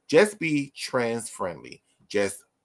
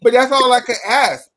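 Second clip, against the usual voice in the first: high, loud insistence; loud protest; 10 semitones higher, 9 dB louder than usual. Real, not acted. Guest defending her idea of respect to laughing friends, loudly insisting. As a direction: push back over the laughter. Defending herself amid teasing.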